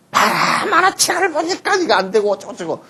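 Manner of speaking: high, shrill-pitched mock nagging parent; very high mock scold about comics.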